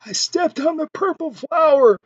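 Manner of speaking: high, near-tearful whimper; almost crying. A narrator's reading. Second friend caught with the ugly woman, near-tearful whimper. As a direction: say it like you're about to cry. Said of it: "almost crying".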